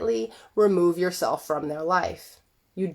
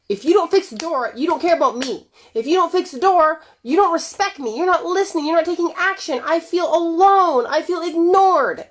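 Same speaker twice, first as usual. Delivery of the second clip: wife voicing her hurt, much higher; 9 semitones higher, 4 dB louder than usual. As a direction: list each hurt, rising higher. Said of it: "Your reality is".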